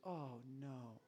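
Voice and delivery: soft, low, sighed oh no; gentle oh no. Shown as a demonstration. Pastor showing parents a soft sorry oh no. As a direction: breathe it out with sorrow. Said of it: "You just".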